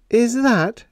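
high, fretful demand. A narrator's reading. Helen fretfully demanding Jim's promise. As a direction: press him, fretting. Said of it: "she demanded fretfully".

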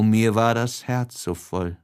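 ordinary delivery, nothing marked

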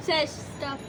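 ordinary delivery

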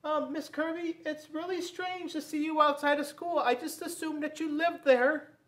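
put-on high, earnest child's voice; curious boy. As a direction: wonder aloud like a little kid. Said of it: "Robert said".

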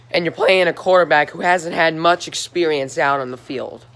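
ordinary talk, nothing marked

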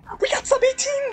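high triumphant yell; excited shout. Real, not acted. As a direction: shout with joy. Record run just finished.

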